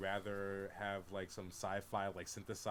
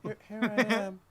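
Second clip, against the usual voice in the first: higher put-on character voice; mock movie character; 10 semitones higher, 10 dB louder than usual. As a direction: ham up the film line as a joke. Quoting Dune character line.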